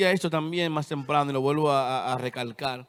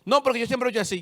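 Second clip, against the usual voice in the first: higher, louder, fast whiny excuse; mock objector; 6 semitones higher, 4 dB louder, much faster than usual. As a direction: toss off the excuse like a stubborn churchgoer. Mocking a stubborn objector. (imitando a un creyente terco que se excusa)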